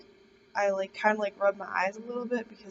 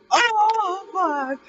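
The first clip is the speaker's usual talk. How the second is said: terrified outcry; 11 semitones higher, 12 dB louder than usual. Retold or imitated. Terrified at the voice behind.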